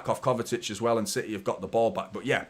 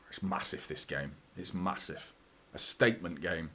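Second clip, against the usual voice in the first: whispered, much softer; tense whisper about a big game; hushed; 6 semitones lower, 9 dB quieter than usual. Real, not acted. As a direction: breathe it out under the tension.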